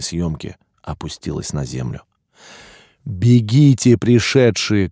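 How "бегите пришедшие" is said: loud, raised urgent call; urgent distant warning; calling from afar. A narrator's reading. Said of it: "как издалека донёсся до меня голос хранителя".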